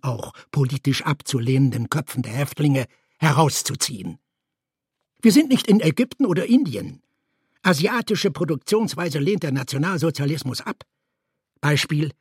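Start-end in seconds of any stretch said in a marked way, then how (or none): none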